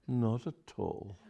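quiet, slow chill; cold rebuff. Acted. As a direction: shut him down slowly. Charlotte rebuffs Aubrey.

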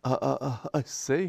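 halting, stumbling start; mild stammer. Acted. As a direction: trip over the words in embarrassment. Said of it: "he stammered".

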